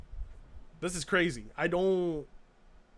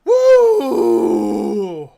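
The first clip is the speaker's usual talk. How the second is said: long, very loud whoop; 2 semitones higher, 12 dB louder than usual. Real, not acted. Streamer whoops to chat over the rival's dislikes. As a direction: let out a big celebratory woo.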